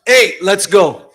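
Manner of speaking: slow, loud barked call to his son; a father yelling at his son. Retold or imitated. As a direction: yell it impatiently across a room. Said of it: "Then he yells".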